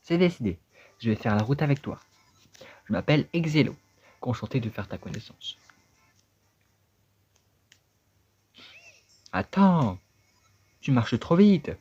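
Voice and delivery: high, loud bossy character voice; a cranky little creature ordering the kid to help; impatient talking hat. Acted. Talking hat scolding the boy to help him, high and loud.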